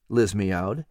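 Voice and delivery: ordinary delivery, nothing marked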